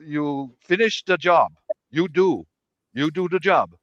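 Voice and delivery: imitated Korean accent, halting and deliberate; broken Korean English. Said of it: "his English was pretty broken".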